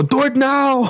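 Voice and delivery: high-pitched mock Austrian command; Arnold Schwarzenegger. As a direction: imitate an action star yelling an order. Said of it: "one that was Arnold Schwarzenegger".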